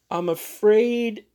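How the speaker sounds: slow, high, careful start; hesitant. Acted. Thurkell to the ladies, slow and careful. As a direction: ease into the bad news. Thurkell begins bad news.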